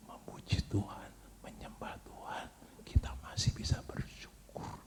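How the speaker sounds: hushed unvoiced whisper. Shown as a demonstration. Preacher whispering to the congregation. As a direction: drop to a whisper so they lean in. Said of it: "Saya ngomongnya pelan-pelan".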